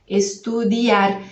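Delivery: Estudiar slowly, the I quick and light, the A stressed. Shown as a demonstration. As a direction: glide past the I and lean on the A in Estudiar. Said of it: "O A é que a gente vai pronunciar com mais ênfase".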